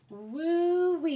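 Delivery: high, loud whoop; a thrilled whoop of delight; excited exclaim. Real, not acted. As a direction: let out a thrilled woo. Reacting to the card message.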